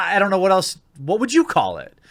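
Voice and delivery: ordinary talk, nothing marked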